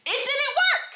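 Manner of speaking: high, exasperated outburst; angry yelling. Real, not acted. Survivor fed up with praying pain away, yelling. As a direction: burst out high in real frustration. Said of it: "I've been yelling".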